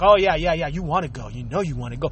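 little devil. Retold or imitated. The little devil in his head tempting him, low coaxing voice. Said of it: "like having that little devil next to you".

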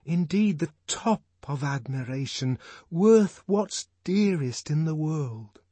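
high, slow lovestruck declamation; worshipful lover reciting verse; adoring recital. A narrator's reading. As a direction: draw it out reverently, pitched high.

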